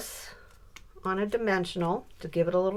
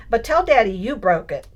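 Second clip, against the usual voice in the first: teasing grandma; 3 semitones higher, 9 dB louder than usual. Retold or imitated. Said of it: "grandma about to have fun".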